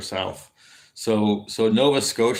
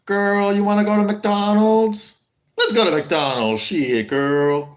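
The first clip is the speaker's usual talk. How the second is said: higher, louder, hammed-up sassy lady voice; 10 semitones higher, 5 dB louder, slower than usual. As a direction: call out to your girlfriend like an exaggerated sassy lady. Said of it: "Like that".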